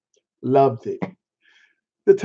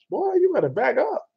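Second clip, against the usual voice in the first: higher, softer playful threat; mock warning; 7 semitones higher, 5 dB quieter than usual. Defending his chitlins, a high mock threat. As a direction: tease them to back off.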